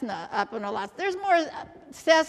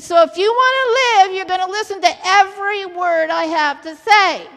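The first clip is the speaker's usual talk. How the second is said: loud, raised, emphatic command; forceful warning; 3 semitones higher, 7 dB louder than usual. Retold or imitated. Controller tells passengers how to survive, forceful warning. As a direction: press it on them loudly, as life or death.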